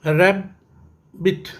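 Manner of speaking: Rabbit slowly split as रै, pause, बिट; rabbit in two drawn-apart beats; syllable by syllable. Shown as a demonstration. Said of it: "ये भी टू syllable है".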